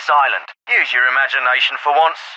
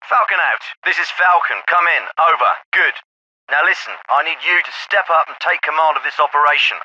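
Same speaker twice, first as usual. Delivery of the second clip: ordinary talk, nothing marked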